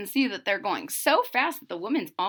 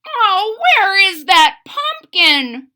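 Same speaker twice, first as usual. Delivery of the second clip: put-on high, loud old-man croak; croaky old man; 8 semitones higher, 8 dB louder, slower than usual. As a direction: moan it out like an ancient man. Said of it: "croaked the old man".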